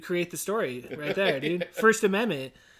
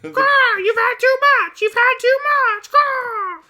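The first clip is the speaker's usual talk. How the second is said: very high, loud parrot squawk; piercing cartoon bird voice; Polly; 7 dB louder, slower than usual. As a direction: screech it like a talking parrot. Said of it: "thing that Polly would say".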